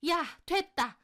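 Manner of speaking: high, loud mother-in-law voice; fed-up outburst. Acted. Mother-in-law dismisses the wife mid-quarrel, fed up. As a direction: cut the quarrel off loudly.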